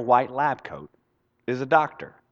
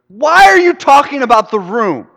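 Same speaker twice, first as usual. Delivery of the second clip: loud, high-pitched exasperated yell; frustrated shout; 11 semitones higher, 9 dB louder than usual. Acted. Frustrated son at the man in his dad's room, loud shout. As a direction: yell it in utter disbelief. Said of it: "gets really frustrated".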